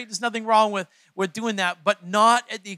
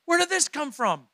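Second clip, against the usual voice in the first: high-pitched retold astonishment; startled amazement; 8 semitones higher than usual. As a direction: act taken aback by your own outburst. Acts own surprise at his outburst.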